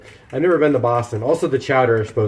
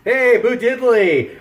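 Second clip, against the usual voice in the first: much higher, slow drawn-out hello; excited greeting; 10 semitones higher, 3 dB louder, much slower than usual. Real, not acted. Greeting a viewer in chat.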